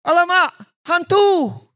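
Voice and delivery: very high, drawn-out mock alarm; terrified cry. Disciples seeing Jesus on the water, mock terrified cry. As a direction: cry out in hammed-up terror at a ghost. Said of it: "And they were terrified, thinking it's a ghost".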